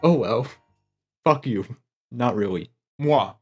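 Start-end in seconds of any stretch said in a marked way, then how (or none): none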